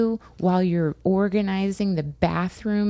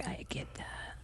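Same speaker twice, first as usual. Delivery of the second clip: breathy unvoiced whisper; 18 dB quieter than usual. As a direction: whisper it close. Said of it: "you're like".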